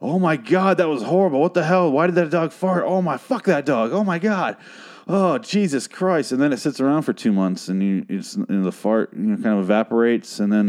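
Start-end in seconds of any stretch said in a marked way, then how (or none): 0.0-6.3 high, disgusted, gasping at the stench; hammed-up disgust, high and outraged; mock disgust. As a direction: act revolted by a dog fart. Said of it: "And you're kinda like".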